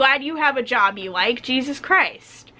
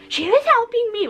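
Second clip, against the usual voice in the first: much higher mock whine; whiny customer; 11 semitones higher than usual. Mocking a customer who claims the worker's attention, high whiny voice. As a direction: whine it like a spoiled child.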